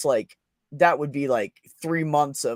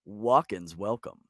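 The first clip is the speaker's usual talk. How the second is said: loud, booming store-ad announcer; hammed-up commercial announcer; 3 semitones higher, 9 dB louder, slower than usual. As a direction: pitch it like a cheesy radio ad. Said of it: "I'm gonna do it in my announcer voice".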